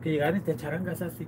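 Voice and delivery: normal talking voice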